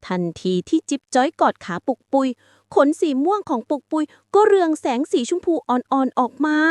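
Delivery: usual speaking voice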